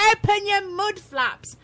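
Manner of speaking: high, loud, slow "open your mud flaps"; mock exasperated. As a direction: bark it at a co-host who won't listen. Co-host keeps mishearing him.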